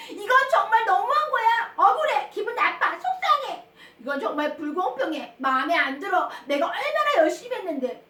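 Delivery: hammed-up child's indignant complaint; upset and angry. Child left out of the parade vote, acted angry protest. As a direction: act out feeling wronged, starting loud and high. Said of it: "너무너무 화가 나고 기분이 나빴어요".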